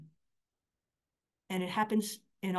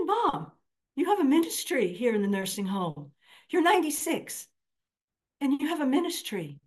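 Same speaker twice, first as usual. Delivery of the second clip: excited telling mom; 6 semitones higher, 5 dB louder than usual. Real, not acted.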